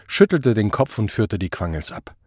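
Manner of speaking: ordinary delivery, nothing marked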